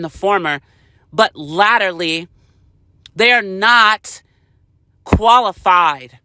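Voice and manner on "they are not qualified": each word spaced out and hit; a pointed, deliberate hammering of the words; slow emphasis. Real, not acted. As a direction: drag out they are not qualified.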